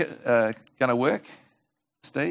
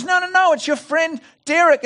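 much higher, insistent pleading; 12 semitones higher than usual. Acted. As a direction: beg him to believe you.

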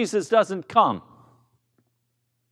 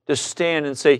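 ordinary talk, nothing marked

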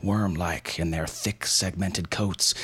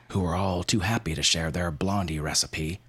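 ordinary delivery

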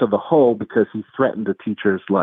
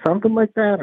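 "Something like that?" jumps much higher in disbelief; incredulous echo; 11 semitones higher than usual. Retold or imitated. Counselor echoing the inmate's dodge back at him, incredulous. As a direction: throw his own words back at him, questioning.

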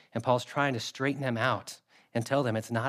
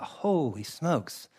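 stunned reaction; 2 semitones higher, much slower than usual. Retold or imitated. His shocked reaction to the story.

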